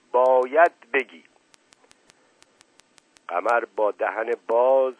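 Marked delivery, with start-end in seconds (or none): 0.1-1.2 loud, slow demand; forceful raised-voice command; shouting. A narrator's reading. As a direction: shout it as an order, drawing it out. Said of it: "فریاد کشید".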